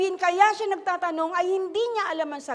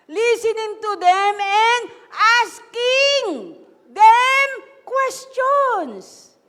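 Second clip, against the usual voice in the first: preacher reading the verse aloud, stretched out; slow emphatic reading; 6 semitones higher, 9 dB louder, much slower than usual. Real, not acted. Preacher reads scripture to the congregation, slow and loud. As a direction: read out slowly and loudly, word by word. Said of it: "basahin niyo po yung dulo".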